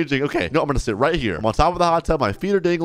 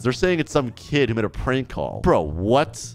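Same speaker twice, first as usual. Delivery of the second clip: ordinary talk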